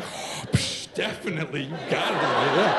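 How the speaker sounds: respirator hiss, then low villain voice; dad playing along as Darth Vader; talking like Vader. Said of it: "can we talk like that".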